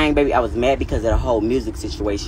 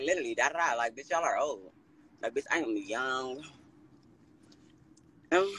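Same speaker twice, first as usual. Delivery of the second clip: ordinary delivery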